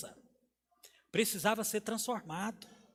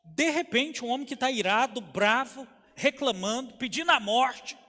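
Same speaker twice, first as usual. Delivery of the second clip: raised, forceful preaching voice; pregação vigorosa; 5 semitones higher, 7 dB louder than usual. Acted. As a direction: preach it high and loud, punching key words. (pregação intensa, em voz elevada)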